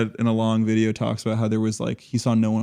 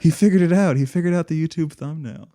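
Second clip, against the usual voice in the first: higher, louder excited cheer; mock triumph; 6 semitones higher, 4 dB louder than usual. Host cheering the hobo's thumbnail breakthrough, excited mock triumph. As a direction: celebrate like he cracked the code.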